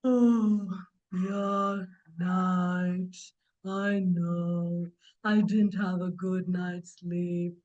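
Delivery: low, very slow, drawn-out sleepy voice; sleepy tortoise. Acted. Uncle Tortoise woken from sleep, answering drowsily. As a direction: drag each word out, low and drowsy.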